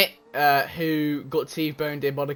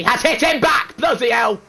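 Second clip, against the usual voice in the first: excited shout; 8 semitones higher, 7 dB louder than usual. Real, not acted. Collision called live.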